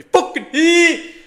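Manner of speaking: high, loud shout; angry shout. Real, not acted. Trader shouting at the market, angry shout. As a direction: shout at the chart.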